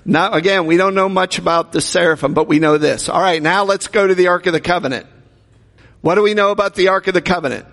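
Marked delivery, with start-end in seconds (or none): none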